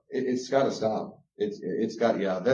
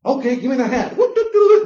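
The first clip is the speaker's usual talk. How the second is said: very high, loud, laughing sing-song; mocking a performing kid; 12 semitones higher, 9 dB louder than usual. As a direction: ham up a kid forced to dance with a laugh.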